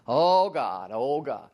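loud, hammed-up plea to God; mock fervent prayer. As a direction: play at working up faith by praying hard. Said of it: "if we just get really serious".

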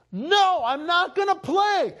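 loud, high, stubborn refusal; father yelling. Retold or imitated. Dad refusing mom about playing catch, yelling. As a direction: shout back, refusing outright. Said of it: "fighting and yelling at each other".

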